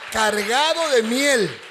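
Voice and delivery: loud, slow, savoring the words; loud emphatic preaching. Acted. Preacher booming to the congregation about the sweet kiss. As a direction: belt it out, stretching the honey line.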